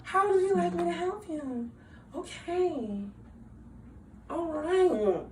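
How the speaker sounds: sweet, eager to please; customer service voice. Shown as a demonstration. What coworkers saw at work, a sweet service voice. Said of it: "You saw".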